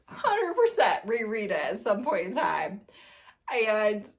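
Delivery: normal talking voice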